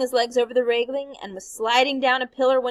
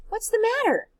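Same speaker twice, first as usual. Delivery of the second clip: put-on alarmed child; 12 semitones higher, faster than usual. Child senses trouble, alarmed.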